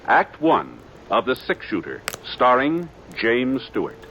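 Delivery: ordinary talk, nothing marked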